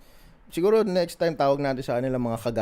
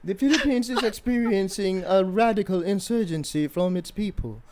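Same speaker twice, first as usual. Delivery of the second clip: higher-pitched mock British news report; posh British accent; 4 semitones higher than usual. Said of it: "it's a posh British accent".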